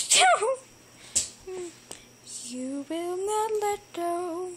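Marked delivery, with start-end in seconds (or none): 0.1-0.6 loud high ending note. Pretend. Tune ends on a loud note.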